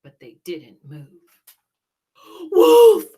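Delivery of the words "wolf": high, loud shout; crying out. Acted. Jack crying wolf at the lazy birds. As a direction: sound the alarm full force. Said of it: "he cried".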